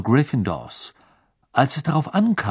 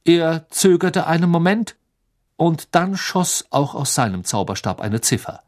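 ordinary delivery, nothing marked

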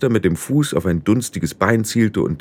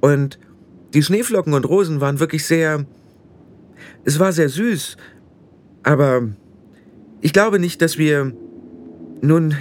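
put-on higher-pitched girl, hesitant and apologetic; halting, higher voice, searching for polite words; Tiffany, awkwardly polite; 5 semitones higher than usual.

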